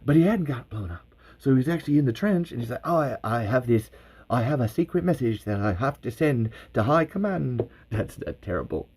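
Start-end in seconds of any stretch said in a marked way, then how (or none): none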